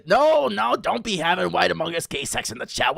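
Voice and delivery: high, incredulous protest; mock scolding. Real, not acted. Streamer scolding chat's antics, mock-outraged protest. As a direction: jump up in pitch to tell them off.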